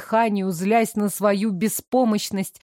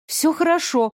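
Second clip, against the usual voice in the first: stubborn; 7 semitones higher than usual. Acted. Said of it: "упрямо повторила я".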